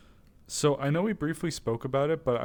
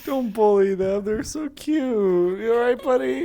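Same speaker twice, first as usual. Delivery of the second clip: higher and louder, gushing over something cute; doting pet talk; 9 semitones higher, 8 dB louder than usual. Real, not acted. Scolding friends then cooing at the creature, loud doting voice. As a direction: gush protectively at the little thing.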